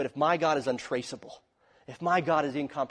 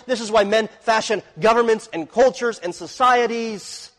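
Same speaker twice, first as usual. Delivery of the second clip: louder and higher, driving each phrase home; full-force preaching; 6 semitones higher, 8 dB louder than usual. Real, not acted. Preacher proclaiming God's provision to his congregation, forceful and raised.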